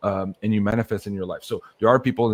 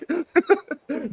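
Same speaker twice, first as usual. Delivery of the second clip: much higher giggling laugh. Retold or imitated. Visitor laughing at the elves' demands, high giggle. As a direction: burst into a squeaky laugh.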